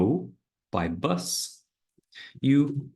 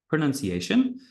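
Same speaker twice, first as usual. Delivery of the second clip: ordinary delivery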